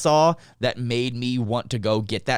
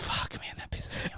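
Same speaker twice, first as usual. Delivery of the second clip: unvoiced frustrated whisper; 12 dB quieter than usual. Real, not acted. Reacting to the friend's good steak, envious whisper. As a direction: hiss it under your breath in envy.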